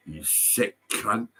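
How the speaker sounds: usual speaking voice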